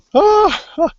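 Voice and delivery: loud, very high cackle tailing off; sudden high-pitched ha-ha; high laugh. Real, not acted. Laughs at people's belief.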